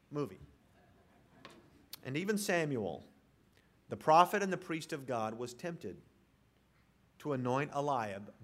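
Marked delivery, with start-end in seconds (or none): none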